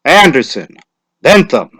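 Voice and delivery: loud roll call; name called out loud. A narrator's reading. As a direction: call the name across the crowd. Calling names at lottery.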